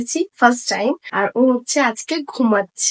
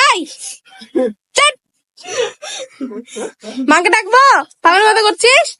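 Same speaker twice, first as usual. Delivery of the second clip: scolding; 5 semitones higher than usual. Real, not acted. Scolding a splashing pet.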